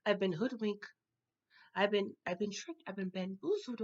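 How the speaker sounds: hushed mock betrayal; stunned realisation. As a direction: let it sink in, very softly. Acts out discovering she was tricked.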